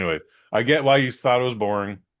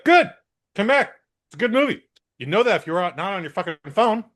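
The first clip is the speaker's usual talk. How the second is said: high, raised-voice retort; mock scolding; 10 semitones higher, 3 dB louder than usual. Shouting back at the departing phone user, mock indignant. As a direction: call after him, pitched well up.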